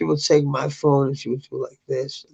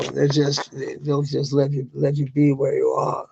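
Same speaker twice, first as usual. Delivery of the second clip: ordinary talk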